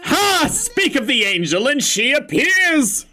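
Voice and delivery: high, loud, bubbly friend calling out a good morning; excited friend. Acted. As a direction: burst in overexcited and cheerful. Vriend ziet Aoi.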